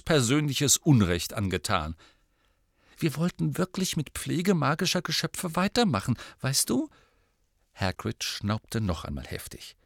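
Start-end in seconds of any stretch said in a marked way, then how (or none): 3.0-6.9 put-on higher girl's voice; eager young girl, pitched up; Hermione. A narrator's reading. Hermione's line, voiced high.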